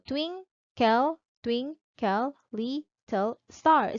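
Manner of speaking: "twinkle, twinkle, little star" broken slowly into seven separate syllables; slow, evenly split "twin-kle, twin-kle, lit-tle star". Shown as a demonstration. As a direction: count out each syllable of "twinkle, twinkle, little star", pausing after each. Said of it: "just count the units of sounds".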